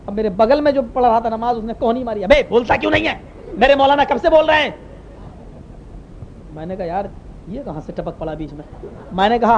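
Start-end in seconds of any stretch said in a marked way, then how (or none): 2.2-4.7 higher-pitched indignant retold rebuke; mock scolding. Neighbour nudges him to answer the maulana, mock scolding. 6.8-8.9 low, soft grumble; muttered aside. Retold or imitated. Worshipper about the meddling neighbour, quiet low muttering.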